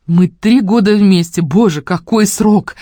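slow, loud mock awe; mock dramatic. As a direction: ham up the anniversary. Mocking repeat of line.

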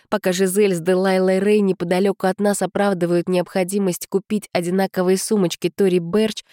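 plain reading voice

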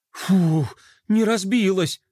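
a big phew of relief; relieved exhale. A narrator's reading. Boy exhaling after saving the mirror, relieved. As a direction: puff out breath, then speak with relief. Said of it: "с облегчением выдохнул Флай".

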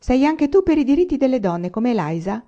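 high, surprised question; startled astonished question. A narrator's reading. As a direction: ask in sudden amazement. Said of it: "Almanzo la guardò stupito".

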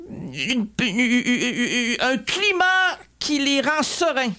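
put-on stammering, drawn-out older entertainer voice; odd, halting, slowed impression. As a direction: stutter on "une", then slowly lift the pitch. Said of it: "la voix était un peu étrange".

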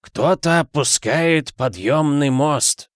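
slow, high cry of alarm; high, drawn-out warning call; calling back. A narrator's reading. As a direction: raise the alarm with stretched words. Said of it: "откликнулся Ассолин".

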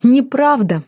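high, mocking repeat of the denial; scoffing, pitch lifted; derisive echo. A narrator's reading. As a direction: throw the word back scornfully. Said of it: "Хелен расхохоталась".

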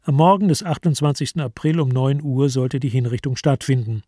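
normal talking voice

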